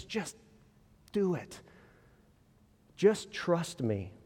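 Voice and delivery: Jesus softly reassuring a stubborn doubter, slow and hushed. Retold or imitated. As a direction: plead quietly, trust me.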